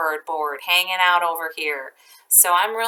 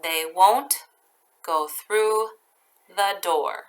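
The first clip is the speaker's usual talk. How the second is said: slow dictation; slower than usual. Shown as a demonstration. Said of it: "Touch and listen".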